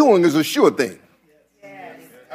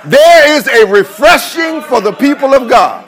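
preaching it out louder; rising preaching; 4 semitones higher, 5 dB louder than usual. Real, not acted. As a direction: repeat it with growing force.

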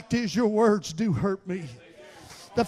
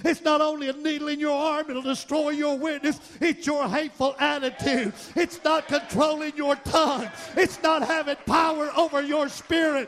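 fiery preaching; 9 semitones higher than usual. Acted. Pastor whooping to the congregation, fiery preaching. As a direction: preach it at full force, building.

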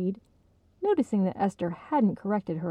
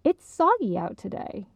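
higher, firm declaration; firmly; 6 semitones higher than usual. Acted. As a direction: state it firmly. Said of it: "firmly repeated".

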